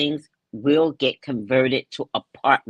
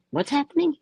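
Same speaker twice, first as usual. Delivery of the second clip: God voicing; 12 semitones higher, faster than usual. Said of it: "God is like".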